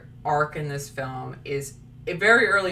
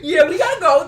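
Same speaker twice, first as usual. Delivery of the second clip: laughing; 10 semitones higher, 7 dB louder, faster than usual. Real, not acted. Guests hurrying to leave.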